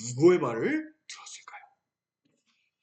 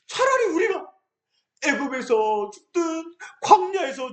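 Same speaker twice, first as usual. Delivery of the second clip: tearful complaint; 7 semitones higher than usual. Acted. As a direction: whine and sob the accusation. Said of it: "하나님께 원망했어요".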